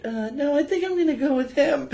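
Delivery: very high, put-on female voice; mock girl voice. Girl turning down the beaten-up guy, high mock voice. Mimicking buddy's date turning him down.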